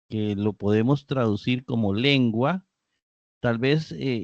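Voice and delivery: ordinary talk, nothing marked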